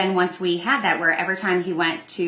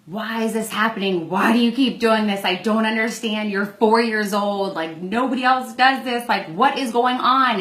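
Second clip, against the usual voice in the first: put-on raised, exasperated scolding of a four-year-old; exasperated yelling at child; 4 semitones higher than usual. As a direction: reenact yelling in frustration at your son. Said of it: "my anger then was lashing out at him".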